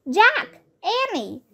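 high call that falls away on Annie; a boy calling friends in the fading light. A narrator's reading. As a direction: shout their names across the yard. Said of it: "calling to them".

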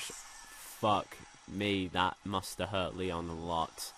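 ordinary talk

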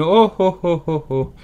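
high hooting wails of "oh"; loud cries tapering off; train-whistle cries. A narrator's reading. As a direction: wail like a locomotive whistle. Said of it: "Ses cris ressemblaient si exactement au sifflet d'une locomotive".

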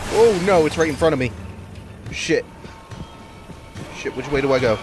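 high panicked cries; panicking as the monster appears; scared horror game streamer. Real, not acted. Monster right in front.

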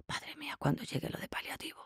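unvoiced dread under her breath; a frightened breathy whisper to herself. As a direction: whisper it so no one hears.

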